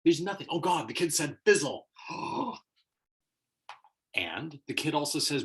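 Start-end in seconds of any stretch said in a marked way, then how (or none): 0.5-1.9 loud, higher-pitched mock panic; mock horror. Lecturer mimicking an adult hearing a kid swear, mock horror. Mocks adults' overreaction to word. 1.9-2.6 high, scandalized gasp; mock shocked gasp. Adult reacting to a kid saying fizzle, mock gasp.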